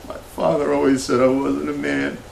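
high, upset voice; upset confession. Acted. Host confessing his father's disapproval, upset and high. Mock breakdown over unloving father.

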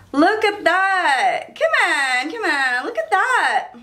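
high, slow and gushing; can't contain it, show it off; excited. Real, not acted. Vlogger showing viewers her new frames, gushing excitedly.

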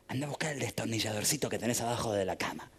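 low, hushed conspirator's voice; secretive instruction. Acted. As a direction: give secret orders softly and low. Gnome gives secret instructions.

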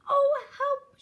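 high, loud frightened wail; quaking little pig; shivering fear. A narrator's reading. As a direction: plead for help in a piglet squeal. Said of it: "shivered Horace".

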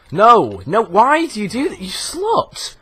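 very high, loud squeal of outrage; shrieked protest. Real, not acted. Gamer shrieking at the game for betraying him, outraged squeal.

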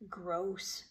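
low, quiet mutter; softly muttered disgust. A narrator's reading. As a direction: mutter it under your breath. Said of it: "he muttered".

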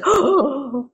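imitating a sharp, high startled gasp; mock gasp. Dad hearing police threat, a high mock gasp. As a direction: gasp like someone suddenly terrified. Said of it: "and he was like".

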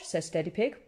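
ordinary delivery, nothing marked

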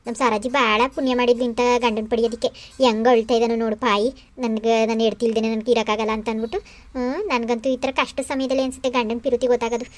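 low-pitched mother-in-law, talking to herself; pleased private aside. Acted. Mother-in-law privately admiring her weeping son-in-law, pleased private aside. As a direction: drop the voice low and confide contentedly.

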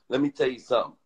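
ordinary talk, nothing marked

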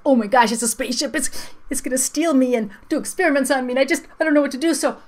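hammed-up panic, fast, loud and rising; panicking vowel. Vowel sees a spaceship coming to steal it, frantic mock panic. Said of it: "and the vowels are like".

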